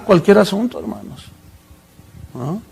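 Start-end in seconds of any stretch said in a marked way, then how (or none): none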